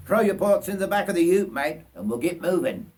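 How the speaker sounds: high, loud and fast broad Australian order; hearty Australian bushman. Acted. Bushman telling Richard to load his bags, loud broad Australian. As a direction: rush the newcomer along, Australian style. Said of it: "broad Australian voice".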